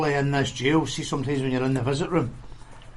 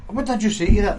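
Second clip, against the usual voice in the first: higher, louder quizzing; mock testing challenge; 7 semitones higher, 6 dB louder than usual. Testing Buster's listening, higher and louder. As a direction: catch him out. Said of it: "I'd be like".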